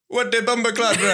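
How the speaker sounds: put-on quick Jamaican accent on request; playful Jamaican accent bit. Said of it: "Give me a Jamaican accent real quick".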